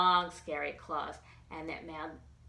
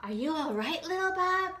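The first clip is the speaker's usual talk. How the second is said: higher, gentle worried voice; worried concern; 9 semitones higher than usual. A narrator's reading. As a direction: check on him tenderly. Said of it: "gave a worried smile".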